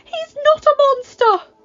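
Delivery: high, loud protest; desperate shout. Acted. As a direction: scream in his defence. Belle defends the beast.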